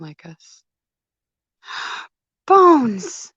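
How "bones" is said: gasp, then a high, loud joyful cry of the name; light up at seeing him; excited gasp. Real, not acted. Streamer greeting a friend's video, excited.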